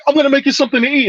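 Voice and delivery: high, fast mother offering food; insistent matriarch. Retold or imitated. Grandmother insisting she feed the gangsters, high and fast. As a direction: push food on them like a grandma. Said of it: "will just be like".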